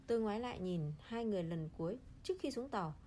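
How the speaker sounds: plain reading voice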